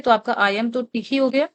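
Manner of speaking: normal talking voice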